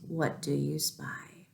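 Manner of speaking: usual speaking voice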